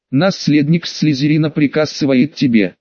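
usual speaking voice

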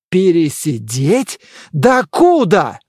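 slow, drawn-out incredulous exclamation; indignant cry. A narrator's reading. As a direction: cry out in disbelief, stretching the words. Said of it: "вскричал монах".

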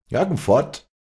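put-on older brother calling out an order. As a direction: call the order across. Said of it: "rief Nikodemus".

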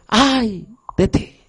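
slow, grieving cry of God; mournful lament. Acted. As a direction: let the woe weep out slowly. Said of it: "Dios llora en ese momento".

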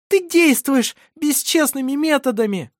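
very high, loud outcry of protest; indignant shout. A narrator's reading. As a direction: cry out indignantly, pitch shooting up. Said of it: "крикнула она возмущённо". (возмущённый высокий крик)

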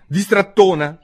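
high, loud scolding; stern. A narrator's reading. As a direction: scold sharply like a cross schoolmaster. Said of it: "disse il topo ad Alice severamente".

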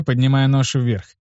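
usual speaking voice

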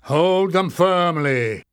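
slow, loud command. Acted. Warren ordering the guards, slow firm command. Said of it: "he said".

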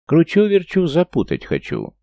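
high, loud sing-song hawker call; loud carnival barker. Acted. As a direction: belt it out like a street con man. Thimblerigger calling crowd.

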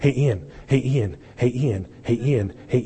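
low, repeated nagging for attention; same call over and over; insistent calling. Pretend. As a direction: keep pestering someone who ignores you. Acting out nagging an ignored man.

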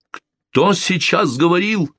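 high, slow shouted question. Acted. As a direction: call out startled. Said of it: "крикнул Эндрю".

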